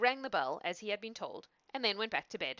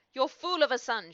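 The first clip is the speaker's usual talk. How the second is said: loud, higher accusing cry; angry cry; 6 semitones higher, 8 dB louder than usual. A narrator's reading. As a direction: hurl it at the father. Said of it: "she cried".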